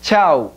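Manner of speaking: CHAO as one syllable, "chow"; Portuguese pronunciation. Shown as a demonstration. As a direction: close CHAO with a u glide. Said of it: "Última vez".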